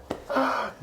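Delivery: sharp, breathy shocked gasp; mock gasp. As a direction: gasp in fake offense. Host mock offended at remark.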